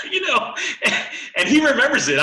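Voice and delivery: laughing through the words, pitch jumping up. Real, not acted. Telling a friend about the tough coach, laughing. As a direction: breaks into real laughter while telling it.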